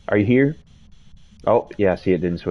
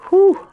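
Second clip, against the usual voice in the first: high, loud startled shriek; scream into giggles; 8 dB louder than usual. Real, not acted. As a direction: shriek from a real jump scare. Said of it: "That was scary".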